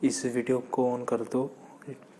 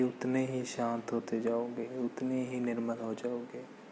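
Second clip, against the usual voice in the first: slow, soft, hushed meditation voice; guided meditation; 12 dB quieter than usual. Acted. Meditation teacher pacing listeners' breath in and out, soft slow guided meditation. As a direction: ease each phrase out gently with long silences.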